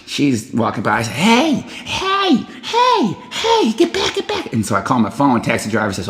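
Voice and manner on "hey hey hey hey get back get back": much higher frantic calls of hey; calling out. Retold or imitated. Calling to a passing lady for help, high calls. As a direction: yell after someone walking away.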